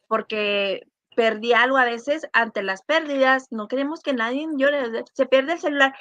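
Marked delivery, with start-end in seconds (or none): none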